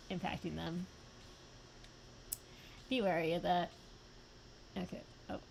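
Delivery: ordinary delivery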